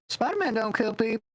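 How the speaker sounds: high-pitched character quote; lightly mocking, high-voiced impression; Aunt May. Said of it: "Aunt May's like".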